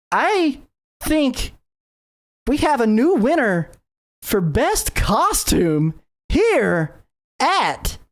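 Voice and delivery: high, slow, drawn-out stage announcement; announcer. Acted. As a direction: announce the winner like a showman. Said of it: "and he goes".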